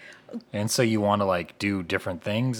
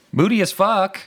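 excited; 12 semitones higher, 9 dB louder than usual. Real, not acted. Loud exclamation about band.

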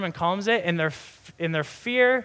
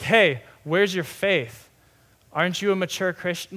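harsh rebuke. Acted. Imagined Jesus to a lapsed believer, scolding. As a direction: call someone out reproachfully. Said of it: "If you hear the rebuke going like".

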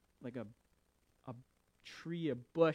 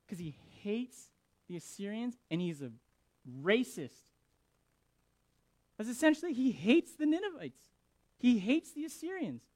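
ordinary talk

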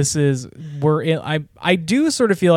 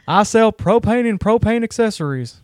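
higher Texan cartoon-dad voice; Hank Hill; 7 semitones higher than usual. Shown as a demonstration. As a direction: deliver it as a Texas propane salesman. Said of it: "Hank Hill".

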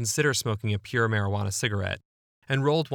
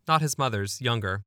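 ordinary talk

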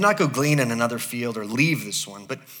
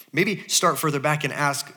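usual speaking voice

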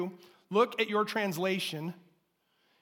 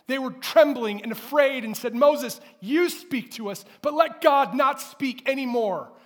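preaching at full force; 3 semitones higher, 7 dB louder than usual. Acted. Pastor urging the church to know God, loud preaching. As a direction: drive each phrase home from the pulpit. Preaching the foundation, then Sinai.

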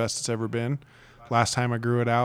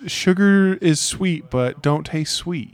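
slow, higher and louder, sarcastic mock quote; mocking paraphrase; 6 semitones higher, 5 dB louder, much slower than usual. Husband mocking wife's advice, slow sarcastic voice. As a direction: drag it out to show how silly it sounds. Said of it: "That's like saying".

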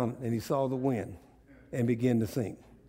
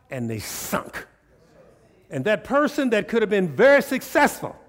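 full-force preaching; 5 semitones higher, 6 dB louder than usual. Acted. Preacher driving the point home to the congregation, loud. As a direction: drive every phrase home from the pulpit.

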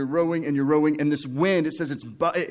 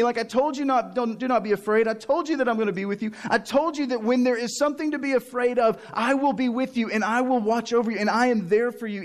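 high, exasperated rant, playfully overdone; mock exasperated scolding; 7 semitones higher than usual. Pretend Jesus berating frightened disciples, exasperated high rant. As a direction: scold them like a fed-up teacher, half joking. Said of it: "No, he didn't say".

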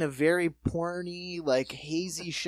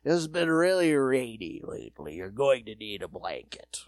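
put-on drunk Raymond Burr; 5 semitones lower than usual. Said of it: "yammering on about just this innocuous".

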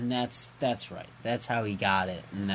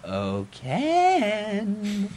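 higher, drawn-out mock call on the name; taunting call; 7 semitones higher, 3 dB louder than usual. A voice calls after Ken as he flees, teasing call. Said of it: "and you hear".

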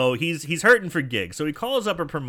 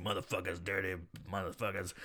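much lower and softer, muttered grumble; grumbling janitor; 7 semitones lower, 14 dB quieter than usual. Retold or imitated. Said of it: "the janitor's walking around bitching like".

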